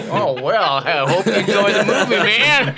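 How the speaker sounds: loud, high, hammed-up Texan drawl; Texan accent. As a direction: burst into a big mock-Texan voice, laughing. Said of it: "start talking like a Texan".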